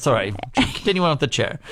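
ordinary talk, nothing marked

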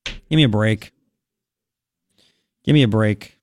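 normal talking voice

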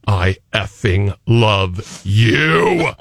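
slow, low "I effing love you", staring them down; slow, deliberate declaration. Shown as a demonstration. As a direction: look them in the eye and drag it out. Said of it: "look 'em right in the eye and go".

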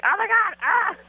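high-pitched frustrated cry; exasperated outburst. Retold or imitated. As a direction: throw up your hands, squeal it. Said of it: "Which makes me like".

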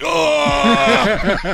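sharp laughing oh; pained cry. Retold or imitated. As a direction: cry out in pain. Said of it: "You're like".

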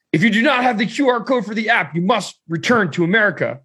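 high, emphatic mock-official ruling; officious border guard. Guard refuses travelers entry, high officious imitation. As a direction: lay down the rule, stiffly.

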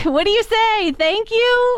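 high, uncertain questioning; baffled, high-pitched mock reply; bewildered retelling. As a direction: thank them as if unsure, in mock bewilderment. Retold bewildered reply to odd compliment.

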